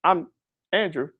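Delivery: awkward greeting. Shown as a demonstration. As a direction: fumble a hesitant hello that fades at the name. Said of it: "don't be this person that's like this".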